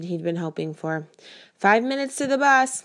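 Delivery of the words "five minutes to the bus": high, loud call upstairs; yelling. A narrator's reading. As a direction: yell the warning across the house. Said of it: "Mom yells from upstairs".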